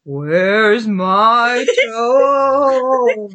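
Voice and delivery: very slow, drawn-out spooky groan; haunting, stretched-out monster call; groaning creature voice. A narrator's reading. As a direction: drag every word into a long moan. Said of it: "it groaned".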